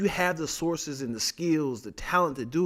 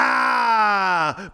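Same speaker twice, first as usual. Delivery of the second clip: frustrated cry; 8 semitones higher, 9 dB louder than usual. Retold or imitated.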